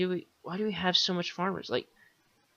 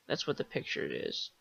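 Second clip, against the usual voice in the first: ordinary talk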